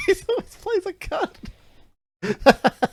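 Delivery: high, very slow words stretched through laughter; laughing. Real, not acted. Mocking the actor's villain roles, laughing hard. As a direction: drag it out while cracking up.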